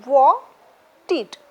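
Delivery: "wanted" with a gap between wan and ted; split into two syllables. Shown as a demonstration. Teacher showing the hyphen's place, syllable by syllable. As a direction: break "wanted" into two separate beats. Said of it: "two syllable".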